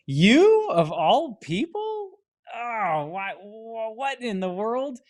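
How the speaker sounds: high, slow, drawn-out shock; can't believe who's asking; incredulous disbelief. Retold or imitated. To the former problem child, high slow stunned disbelief.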